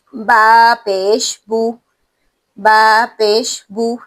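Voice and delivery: بو said slowly with a rounded oo; slow spelled recitation. Shown as a demonstration. Teacher spelling ba with pesh, slow, rounded oo. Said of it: "یو کی آواز یہاں پر آپ نے نکالنی ہے".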